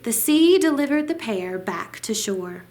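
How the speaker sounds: plain reading voice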